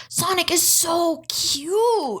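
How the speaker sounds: high, drawn-out gush over something adorable; squeal-pitched and stretched out with adoration. Real, not acted. Podcaster telling co-hosts her high point, gushing.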